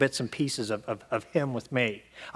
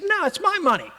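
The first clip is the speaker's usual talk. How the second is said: defiant refusal; 3 dB louder than usual. Retold or imitated. Refusing his father.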